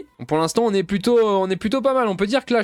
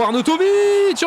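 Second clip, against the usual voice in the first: alarmed call; 9 semitones higher, 4 dB louder, slower than usual. Real, not acted. Opponent breaks through on goal.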